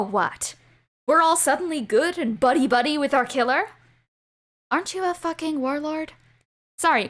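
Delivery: usual speaking voice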